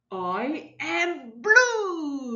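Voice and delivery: slow, awed discovery; a touch of wonder. Acted. Red realises he is blue.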